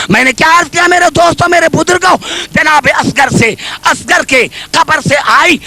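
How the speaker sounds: fast, high, loud preacher's cry; loud preaching. Real, not acted. Preacher calls to friends and elders, fast loud cry. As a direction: push it out fast and high to the hall. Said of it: "मैं जोर से बात कर रहा हूं".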